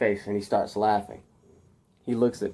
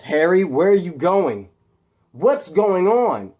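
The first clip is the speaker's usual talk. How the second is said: raised, louder uneasy questioning; uneasy demand; 6 semitones higher, 5 dB louder than usual. Acted. Captive Peter calling after departing Harry, uneasy demand. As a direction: call after him, pitched up and worried. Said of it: "his voice begins to kind of, like, become uneasy".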